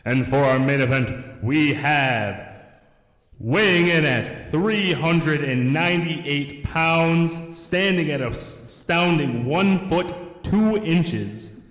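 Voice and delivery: high, slow ring announcer; grand entrance announcement. Pretend. Ring announcer introduces fighter.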